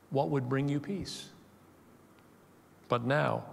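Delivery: a touch of Jesus grieving over the city; weeping lament. Retold or imitated. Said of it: "he wept over it and said".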